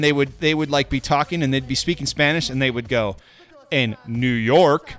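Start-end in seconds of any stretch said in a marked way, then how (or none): 3.7-4.9 very slow, plain American "New York"; drawn-out American-accented place name; imitated American accent. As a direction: mimic a Spanish broadcaster switching to American English. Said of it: "like an, a American person".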